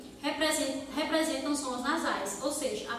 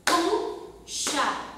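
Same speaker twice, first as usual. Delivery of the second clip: "Concha" said slowly in two separate beats, CON-CHA; syllable by syllable; 4 semitones higher, 4 dB louder than usual. Shown as a demonstration. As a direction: tap out each beat of "Concha". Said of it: "Nós temos concha".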